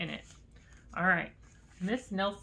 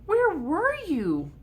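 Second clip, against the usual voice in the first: put-on excited kids; 11 semitones higher, 6 dB louder, slower than usual. A narrator's reading. Kids greeting returned teacher.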